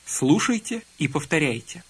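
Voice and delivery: normal talking voice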